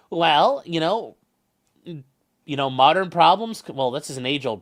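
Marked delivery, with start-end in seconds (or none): none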